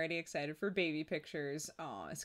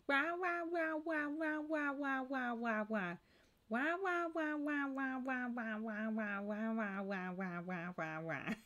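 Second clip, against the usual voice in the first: mock baby crying; 8 semitones higher than usual. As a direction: imitate a baby's wail, dropping down.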